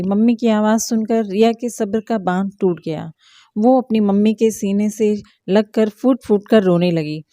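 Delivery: ordinary delivery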